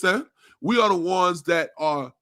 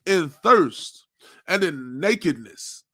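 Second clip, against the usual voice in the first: preaching at full force; 3 semitones higher, 4 dB louder than usual. Real, not acted. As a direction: hammer each word like a street preacher.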